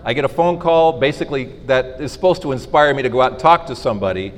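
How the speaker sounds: ordinary talk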